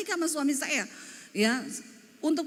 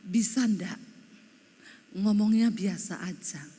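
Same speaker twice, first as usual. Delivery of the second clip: lower, measured husband voice; suami Jawa menegur; 5 semitones lower than usual. Retold or imitated. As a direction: calmly chide her in a lower pitch. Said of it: "Dia bilang gini". (suara suami menegur, nada rendah)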